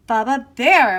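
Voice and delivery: usual speaking voice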